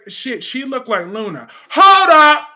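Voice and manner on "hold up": loud startled outburst; shocked shout. Real, not acted. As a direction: yell it out in disbelief. Streamer shocked by viewer comment.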